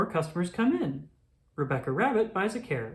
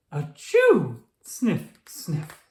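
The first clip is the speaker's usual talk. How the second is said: loud sneeze, then sniffing; 5 dB louder, much slower than usual. Acted. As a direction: sneeze hard and sniff twice. Sick pony sneezing.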